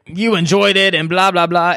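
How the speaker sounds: mock him to his face; taunting a crying boy. Crack mocks Jasper cruelly.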